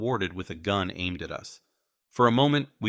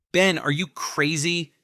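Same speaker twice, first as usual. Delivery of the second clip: tense, urgent and slow, pitched up; urgent hiss; 9 semitones higher, 5 dB louder than usual. A narrator's reading. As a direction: hiss at a reckless friend. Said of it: "Peter hissed".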